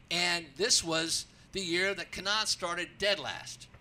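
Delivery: usual speaking voice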